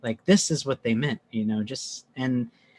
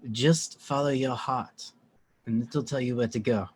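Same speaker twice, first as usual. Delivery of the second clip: lightly mocking French accent; 4 dB quieter than usual. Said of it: "here's my French accent".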